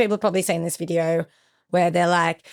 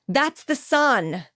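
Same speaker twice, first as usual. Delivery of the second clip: retold call; 7 semitones higher, much slower than usual. Retold teammate calling out correction.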